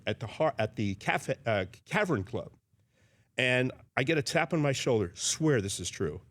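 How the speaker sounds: ordinary delivery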